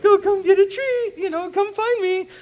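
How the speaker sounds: high-pitched singsong dog-calling voice; sugary, loud falsetto, as if coaxing a runaway dog; pretend loving coaxing. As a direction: call it sweetly, way up high. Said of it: "you gotta pretend you love it even more".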